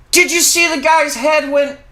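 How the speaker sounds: ordinary delivery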